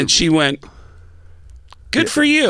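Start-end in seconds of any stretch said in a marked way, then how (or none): 1.9-2.5 high-pitched, unimpressed mock praise; short, high retold dismissal; blank-faced reaction. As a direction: imitate a girl brushing off an impression. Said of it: "It's blank face".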